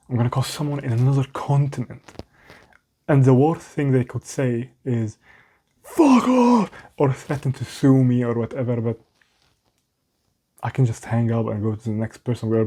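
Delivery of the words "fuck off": mock angry yell. Imagined stranger on the phone telling a cold caller off, mock angry yell. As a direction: pretend callee snapping fuck off, high and loud.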